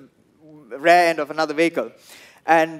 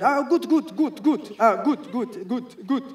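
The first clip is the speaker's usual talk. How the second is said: a light imitation of a security guy chanting good, higher; repeating good; 7 semitones higher, slower than usual. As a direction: chant good over and over like a nervous guard. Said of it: "they keep saying good".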